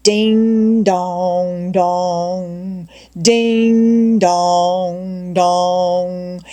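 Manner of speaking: slow, drawn-out ding dong like a steamboat bell; deep-toned bell. A narrator's reading. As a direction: toll it slowly like a boy playing steamboat. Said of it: "followed by a deep-toned".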